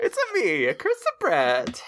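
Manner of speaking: jokey plumber voice, then drop back down; bad Italian accent. Riffing on the Italian joke, a cartoon Italian voice. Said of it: "just really bad Italian".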